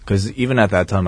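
usual speaking voice

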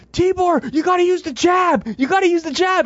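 high yelled advice to the fighter; retold yell. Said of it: "he yells at him".

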